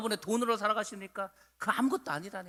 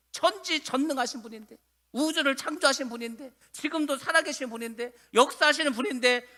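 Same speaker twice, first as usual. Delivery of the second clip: raised, insistent sermon voice; preaching at full force; 6 semitones higher, 7 dB louder than usual. Real, not acted. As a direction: pile up each phrase with rising intensity.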